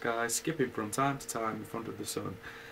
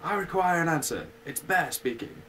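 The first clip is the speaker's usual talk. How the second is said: louder and higher, calling through the door; 7 semitones higher, 6 dB louder than usual. Acted. Pooh at Owl's door, calling out loudly. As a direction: call out to be heard inside. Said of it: "he called out in a very loud voice".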